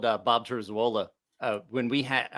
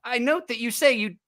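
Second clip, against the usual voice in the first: mock accusation; 8 semitones higher than usual. Teasing Bob.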